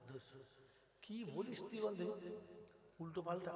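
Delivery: quiet, low disbelieving retort; dismissive aside. Acted. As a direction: drop right down to an offhand murmur. Acts out dismissive reply to angel.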